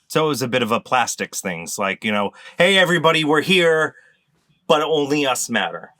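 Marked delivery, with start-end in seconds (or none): none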